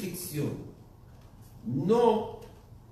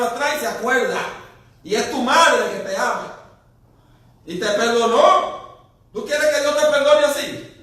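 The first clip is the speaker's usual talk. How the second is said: loud, higher, driving sermon voice; preaching at full force; 5 semitones higher, 8 dB louder than usual. Acted. Preacher challenging the congregation about forgiveness, loud fervent preaching. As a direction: hammer the point home to the pews.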